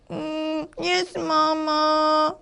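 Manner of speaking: put-on slow, drawn-out child's reply; small, sulky storybook monkey; meek little monkey. Shown as a demonstration. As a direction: answer mama sheepishly, stretching it out. Little monkey answers mama meekly.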